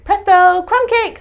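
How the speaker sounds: high loud ta-da announcement; triumphant. Acted. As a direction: show off the finished cake. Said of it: "said Amelia Bedelia".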